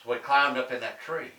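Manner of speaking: plain reading voice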